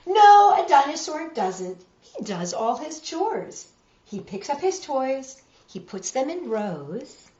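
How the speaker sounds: storybook reading voice; reading to children. A narrator's reading. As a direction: read it like a bedtime picture book. Reads picture book to children.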